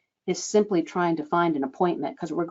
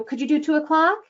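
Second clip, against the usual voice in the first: "o'clock" rising, higher than usual; questioning rise; 6 semitones higher, faster than usual. Shown as a demonstration. Coach showing the wishing mode when offering a time, rising. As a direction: ask it as if hoping for a yes. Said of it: "right on into that question inflection".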